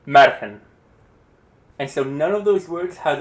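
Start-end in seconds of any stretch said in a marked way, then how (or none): 0.1-0.6 Margen called out loud as MAR-gen; first-syllable stress. Shown as a demonstration. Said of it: "I'm going to say a word".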